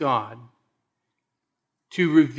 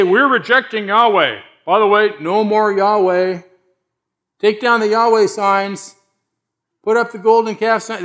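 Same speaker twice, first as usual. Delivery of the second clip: louder, higher mock declaration by the Israelites; 5 semitones higher, 5 dB louder than usual. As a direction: ham up the people rejecting God, loud and high. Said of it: "they didn't say".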